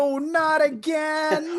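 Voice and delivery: slow, high theatrical anguish; hammy stage actor. Pretend. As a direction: wail it like a ham tragedian. Mock melodrama over Elmo doll.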